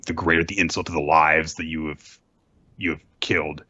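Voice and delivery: lightly voiced icy princess; cold condemnation. Hiyori condemning Orochi, low and cold. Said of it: "she said that".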